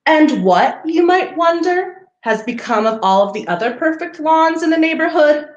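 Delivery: gentle storybook read-aloud; reading to children. Acted. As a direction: read it like a picture book for little ones. Reading picture book to children.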